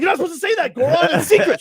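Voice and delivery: a flustered team staffer scolding; mock scolding squeak. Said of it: "And you're like".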